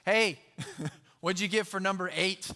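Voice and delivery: loud call across the classroom with a laugh; a buddy calls out from the back of the class, loud and unsneaky; calling across the room. Retold or imitated. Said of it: "It's just".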